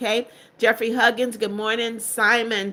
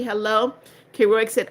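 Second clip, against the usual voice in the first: ordinary delivery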